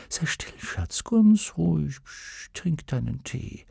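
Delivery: soft insistent whisper; whispered hush. A narrator's reading. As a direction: hush her while forcing the drink. Mutter zwingt zum Trinken, flüsternd. (eindringliches Flüstern)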